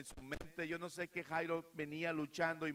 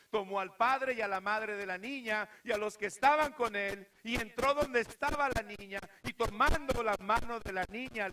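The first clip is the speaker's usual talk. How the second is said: full-force preaching; 6 semitones higher, 8 dB louder than usual. A narrator's reading. Preacher shouting the raising of the girl to the youth. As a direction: shout the miracle to the rafters.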